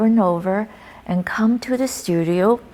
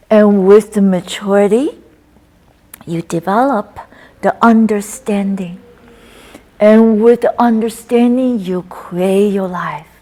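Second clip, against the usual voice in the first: recited qigong poem; 5 dB louder than usual. Acted. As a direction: intone each line as a healing verse.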